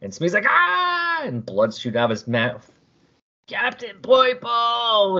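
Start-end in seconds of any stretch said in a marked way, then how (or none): 0.5-1.2 high sharp scream of pain. Acted. Smee seized by the alien, screaming in pain. 3.5-5.2 high, drawn-out desperate call; dying cry. Acted. Dying Smee calling for his captain, drawn-out cry.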